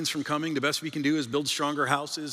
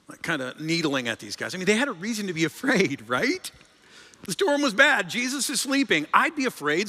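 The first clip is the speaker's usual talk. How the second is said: ordinary delivery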